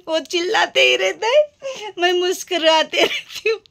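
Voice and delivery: crying and laughing at once; teary laugh. Real, not acted. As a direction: laugh it off while near tears. Husband yells, she smiles.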